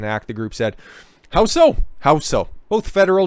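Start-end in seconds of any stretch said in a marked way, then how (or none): 1.3-1.8 high, loud incredulous challenge; incredulous question. Real, not acted. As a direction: ask it high in disbelief. Mocking challenge to the group.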